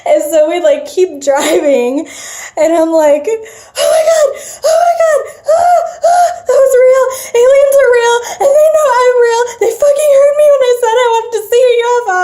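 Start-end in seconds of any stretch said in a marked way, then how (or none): 3.3-12.1 very high, loud, tearful squeal; hysterical re-enacted panic. Speaker to companion after the sighting, crying and shaking. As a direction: shriek it in a rising rush, near tears. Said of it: "I'm, like, crying and shaking".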